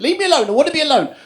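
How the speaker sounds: high, loud, rushed demand; irritated dismissal. Retold or imitated. Girlfriend in labour to her partner, high cross dismissal. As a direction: send them away, high and fast.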